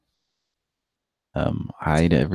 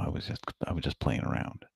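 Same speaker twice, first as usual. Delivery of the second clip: quiet at night; 2 semitones lower, 15 dB quieter than usual. Real, not acted. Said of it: "I can talk somewhat normally though".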